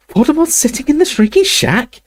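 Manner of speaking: high, indignant outrage; outraged. Acted. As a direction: voice her disbelief sharply.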